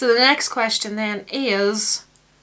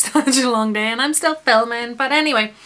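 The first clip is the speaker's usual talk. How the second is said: ordinary talk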